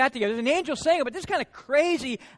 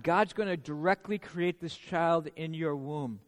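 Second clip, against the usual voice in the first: ordinary talk, nothing marked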